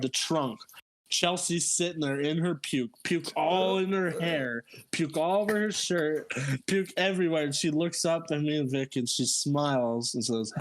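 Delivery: ordinary talk, nothing marked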